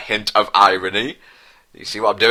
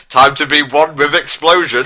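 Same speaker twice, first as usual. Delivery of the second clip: higher, louder mock-dramatic line; hammed-up farewell; 6 semitones higher, 4 dB louder than usual. Sarah says farewell before exploding, mock-dramatic. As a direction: tragic heroine going out. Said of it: "said Sarah as she exploded".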